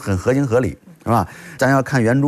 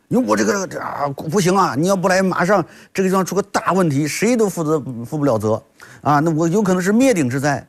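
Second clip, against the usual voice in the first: higher-pitched mock complaint to the boss; alarmist pleading; 6 semitones higher than usual. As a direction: act out a hammed-up scare for the boss. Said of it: "这是吓唬领导的方法". (装作哭诉，吓唬领导)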